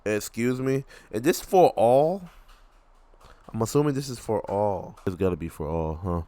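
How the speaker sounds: ordinary delivery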